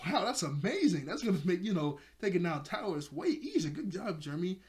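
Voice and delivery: hammed-up praise; imitated amazement. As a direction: act out being impressed, pitch up. Acts out Odd's amazement.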